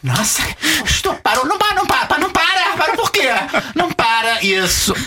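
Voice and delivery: very high, hammed-up ecstatic pleading; mock Brazilian porn moaning. Imitating Brazilian women in adult films, mock moaning. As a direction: squeal it like a parody Brazilian porn actress. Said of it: "as brasileiras têm muito isto".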